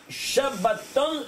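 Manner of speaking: Shabbaton drawn out slowly, each syllable held; slow teaching word. Shown as a demonstration. Teacher drawing out Shabbaton for the student, slowly. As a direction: say Shabbaton slowly, spelling it out by ear. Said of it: "Comme ça, ça s'appelle".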